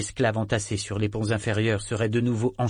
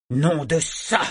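higher exclamation, only moderately raised; shouted curse; 5 semitones higher, 3 dB louder, slower than usual. A narrator's reading. Lieutenant reacts to the sighted galley, crying out. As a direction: swear out loud at bad news. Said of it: "cria Brigg".

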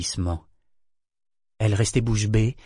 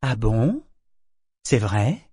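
deadly sweet; slower than usual. A narrator's reading. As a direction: ask with menacing sweetness, rising at the end. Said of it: "d'une voix mortellement douce".

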